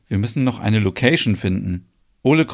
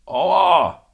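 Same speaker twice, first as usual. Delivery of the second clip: pained cry; 6 semitones higher than usual. A narrator's reading. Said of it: "und ruft".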